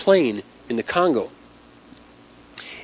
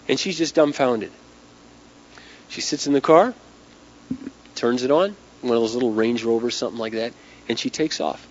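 normal talking voice

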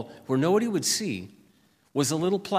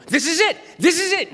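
much higher, louder thrilled shout; excited cry; 7 dB louder than usual. Retold or imitated. Pastor shouting to friend on seeing the perfect house, thrilled. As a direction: burst out with joy at the find.

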